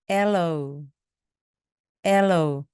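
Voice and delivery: ALOE with a strong opening "AL", then falling; stress on first syllable. Shown as a demonstration. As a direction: hit the front of ALOE, let the end drop. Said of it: "맨 앞에 강세가 있습니다". (aloe의 맨 앞을 강하게)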